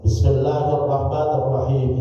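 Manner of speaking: clear, full-voiced recitation at speaking volume; voiced out for the listeners; audible recitation. Shown as a demonstration. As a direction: recite aloud for others to hear. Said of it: "itu jahar".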